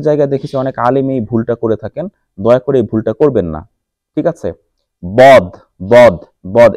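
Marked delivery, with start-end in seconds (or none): 5.0-6.7 বদ with a full, heavy d, not a j; correct dad. Shown as a demonstration. Teacher warns imams against saying dad as j. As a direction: say বদ with a heavy d, never like জ. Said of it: "এই যে আমি যে দদ বললাম".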